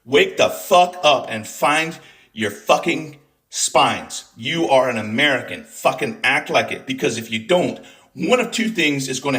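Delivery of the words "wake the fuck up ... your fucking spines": each word slowly pounded out; slow hammered words. Real, not acted. Scolding Democrats, slowly hammering each word. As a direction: spell it out word by word, furious.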